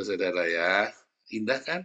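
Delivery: normal talking voice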